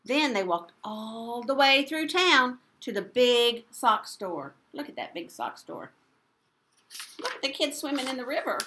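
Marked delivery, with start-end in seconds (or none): 4.7-5.9 teacher whispering aside to listeners. Real, not acted. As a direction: lean in and whisper. Aside to children.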